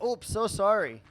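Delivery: high, loud reflex apology; apologetic. Acted. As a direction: blurt out a hammed-up sorry. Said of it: "sometimes I'll even switch on the accent".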